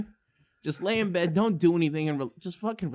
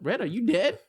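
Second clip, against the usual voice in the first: worried question; 6 semitones higher than usual. Retold or imitated. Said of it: "we're looking at each other like".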